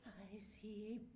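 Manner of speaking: high, hushed eerie goblin voice; spooky goblin. Acted. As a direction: breathe it out like a haunted child. Goblin curled up in ball.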